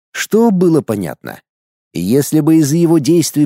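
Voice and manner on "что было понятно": ordinary delivery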